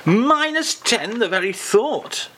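high, drawn-out mock horror; mock aghast. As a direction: savour the shock, slowly. Host mock-aghast at the cold.

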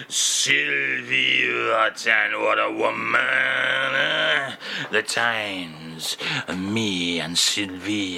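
low, slow, dreamy mock French crooner.

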